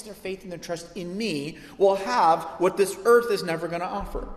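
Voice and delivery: loud, high, forceful sermon voice; building, fervent pulpit proclamation; impassioned preaching. Real, not acted. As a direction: preach it hard, leaning on key words.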